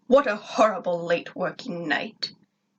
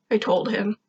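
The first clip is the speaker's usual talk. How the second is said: ordinary delivery, nothing marked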